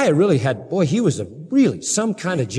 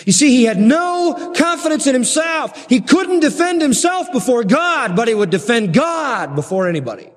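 preaching at full force; 8 semitones higher than usual. Real, not acted. Preaching climax on meekness.

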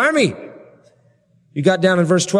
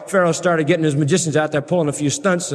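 normal talking voice

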